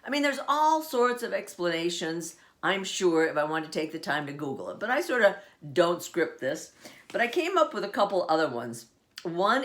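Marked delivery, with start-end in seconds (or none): none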